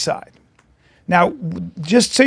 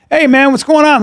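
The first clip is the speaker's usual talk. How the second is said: higher, eager babbling greeting; chatty pesterer; 7 semitones higher, 4 dB louder than usual. Retold or imitated. Imitating somebody coming up at the Comedy Store, chatty. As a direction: mimic a chatterer who rattles on, pitched up. Voicing someone approaching at club.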